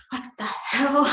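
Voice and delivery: high-pitched, half-laughing outburst; retold disbelief. Woman to smiling Nada as danger grows, mock disbelief. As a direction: exclaim it upward in mock disbelief.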